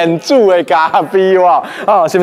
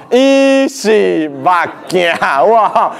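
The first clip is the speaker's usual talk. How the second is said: 伊是目镜 stretched out syllable by syllable; very slow, deliberate 伊是目镜; slow sign reading; much slower than usual. Shown as a demonstration. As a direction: draw out each word of 伊是目镜. Teacher repeats sign slowly for effect.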